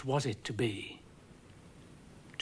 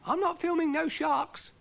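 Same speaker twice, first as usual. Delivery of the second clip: Brian's voice; 4 dB louder than usual. A narrator's reading. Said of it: "said Brian".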